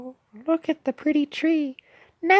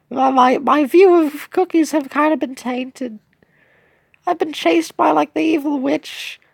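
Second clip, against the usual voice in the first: wistful cookie in British voice. Acted. Cookie confides about lonely winters, wistful British voice. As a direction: play a downtrodden cookie opening up, in British.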